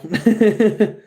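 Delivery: loud, high chuckle; teasing laugh. Real, not acted. After agreeing about the dumbass, a high teasing laugh. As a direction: laugh in a quick high burst.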